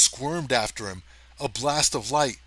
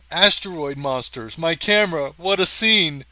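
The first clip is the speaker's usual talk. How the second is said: building louder and higher with thrill; excited shout; 5 semitones higher, 8 dB louder than usual. Acted. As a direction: shout it like you've found the shot of a lifetime. Photographer thrilled at monsters.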